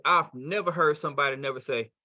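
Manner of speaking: ordinary talk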